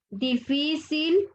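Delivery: "difícil" slowly, weight on "fí"; stress on penultimate syllable. Shown as a demonstration. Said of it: "En la penúltima sílaba".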